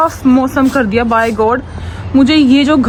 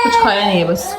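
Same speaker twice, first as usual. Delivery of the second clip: ordinary talk, nothing marked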